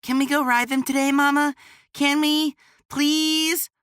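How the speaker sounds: put-on high, fast, pleading little boy; a kid bouncing and begging; excited begging child. A narrator's reading. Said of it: "Cade jumped up and down".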